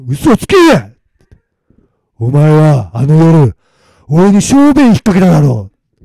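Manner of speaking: loud, drawn-out spirit roar; angry accusation. Acted. Weasel spirit accusing the man who peed on it, angry. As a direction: roar the accusation slowly and forcefully.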